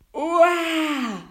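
high, loud swooping yell; startled wail. A narrator's reading. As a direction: wail as if suddenly hoisted up. Tom suddenly picked up.

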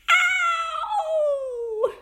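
very high, drawn-out yelp of pain; tiny boy's long high "ow"; pained yell. A narrator's reading. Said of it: "yelled Tom".

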